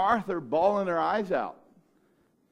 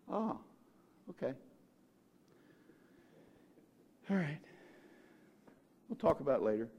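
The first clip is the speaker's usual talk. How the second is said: softer, offhand shrug; casual brush-off; 4 semitones lower, 10 dB quieter, faster than usual. Retold or imitated. Preacher voicing Jesus shrugging off news of sick friend, casual. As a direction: wave it away lightly.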